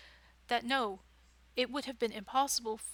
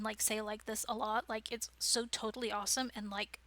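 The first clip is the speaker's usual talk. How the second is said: ordinary talk, nothing marked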